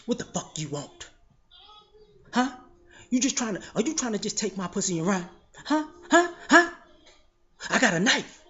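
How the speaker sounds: suspicious woman snapping back, voice put on; a lightly voiced woman pulling a knife, hostile. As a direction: bristle at him like he's a threat.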